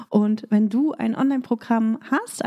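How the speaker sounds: ordinary delivery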